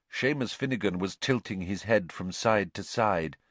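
plain reading voice

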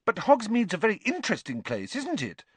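high, eager pressing. A narrator's reading. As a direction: press on brightly, pitched high. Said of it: "Hermione pressed on eagerly".

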